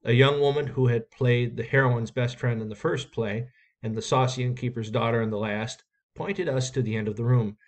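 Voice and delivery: usual speaking voice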